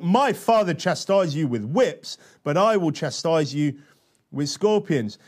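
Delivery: weighty reading, key words punched; emphatic scripture reading. Acted. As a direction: read it out like a bold proclamation.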